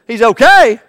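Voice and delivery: loud disbelieving "He's okay?"; incredulous patient. Acted. Hospital patient questioning the nurse, loud disbelief. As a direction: throw it back in shock. Patient doubts doctor in skit.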